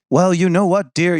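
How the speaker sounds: put-on high, loud old Hollywood Mid-Atlantic accent. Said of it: "kind of New England accent".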